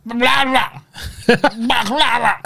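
high mumbled mock of [name]'s Arabic. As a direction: squeak out a garbled parody of his Arabic. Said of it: "How did he say it?".

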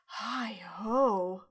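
imitated lovestruck sigh, drawn out; breathy, smitten greeting. Imitating Doug as he sees Evie, breathy and smitten. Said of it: "he stammers out this breathy".

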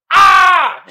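loud, high "ah!" yell; big high holler; excited scream. Real, not acted. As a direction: scream it out then laugh. Excited yell about winning money.

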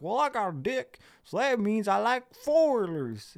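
high, hammed-up simple guy; dim manly-man caricature. Simple man explaining his manliness, hammed-up and high. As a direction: parody a proud dimwit, dragging the last words.

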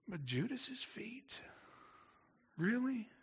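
can't believe he'd wash Judas's feet; incredulous. Real, not acted. Preacher marvels at washing Judas.

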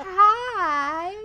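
high, bright little spider greeting. Pretend. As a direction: play a cheerful tiny spider saying hi. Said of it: "You're like".

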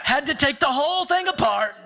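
amused, rueful laugh. Real, not acted. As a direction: laugh through the confession. Laughing at own mistake.

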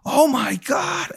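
high, slow "oh my God" of mock horror; drawn-out acted shock; shocked gasp. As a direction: play her stunned, pitch way up. Woman reacts to faked shooting.

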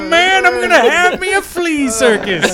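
grandiose flea circus showman. Retold or imitated. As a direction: announce the dream like a showman. Mock villain plans flea circus.

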